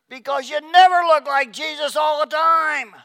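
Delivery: high, loud comic shout; loud punchline. Acted. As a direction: belt out the joke. Punchline about marriage.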